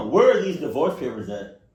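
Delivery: loud, insistent second ask. Acted. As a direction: raise it on the repeat, punching WHERE.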